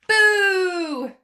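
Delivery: loud high boo; one last cheeky yell; shouted boo. Acted. Cub's last cheeky boo to the listener, loud.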